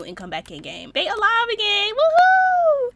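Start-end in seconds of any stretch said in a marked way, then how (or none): 1.9-2.9 high, drawn-out gleeful cheer; celebratory whoop. Real, not acted. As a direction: whoop for their return to life. Cheers that sims are alive.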